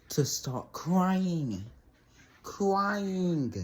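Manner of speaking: "crying" stretched long and pitched high; incredulous emphasis. Real, not acted. Teller appalled she started crying, "crying" drawn out high in disbelief.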